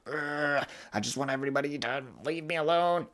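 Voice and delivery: high, whiny, fed-up voice; exaggerated whine of someone done with everyone; fed-up groan. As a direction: moan like you want everyone gone. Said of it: "And I'm like".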